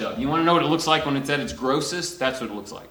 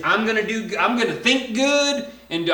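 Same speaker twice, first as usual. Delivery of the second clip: higher, mock eager do-gooder voice; mock self-reliant believer; 8 semitones higher, 3 dB louder than usual. Preacher mocking a works-minded believer, higher mock eager voice. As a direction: sound naively pleased with your own plan. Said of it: "if the idea is".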